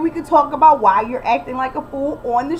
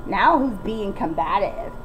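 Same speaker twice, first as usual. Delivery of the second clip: pointed retort; 6 semitones lower, slower than usual. Shown as a demonstration. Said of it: "this is how you have to respond".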